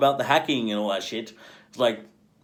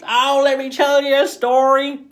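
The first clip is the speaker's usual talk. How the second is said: much higher, loud put-on storyteller; mock storyteller; 14 semitones higher, 7 dB louder than usual. Answering a future viewer, high loud mock storyteller. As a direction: play the old-timer about to spin a yarn. Imagined reply voiced high.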